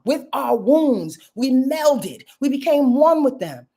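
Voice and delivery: usual speaking voice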